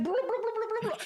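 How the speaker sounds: blah, blah sung up high and dismissive; mock-bored blah blah rising way up. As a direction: brush the letter off with a mocking sing-song. Mocking the letter's filler.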